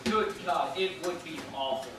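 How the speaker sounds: ordinary delivery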